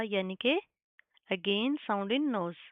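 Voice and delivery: usual speaking voice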